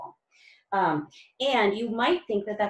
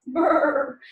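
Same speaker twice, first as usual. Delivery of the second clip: chattering cold brrr; shivering brrr; 5 semitones higher, 6 dB louder than usual. Acted. Narrator to children, a cold shiver sound.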